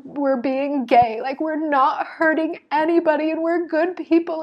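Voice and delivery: high, loud protest poured out; impassioned yelling. Retold or imitated. Woman defending their life to her partner, retold impassioned yell. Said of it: "I am just yelling".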